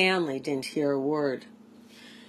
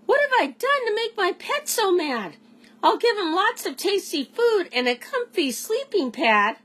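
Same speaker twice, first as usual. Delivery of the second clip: alien Jopner; 13 semitones higher than usual. A narrator's reading. Jopner wonders aloud.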